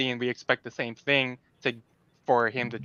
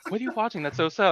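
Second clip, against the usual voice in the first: nosy attendant; 8 semitones higher, faster than usual. Retold or imitated. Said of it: "I'm like".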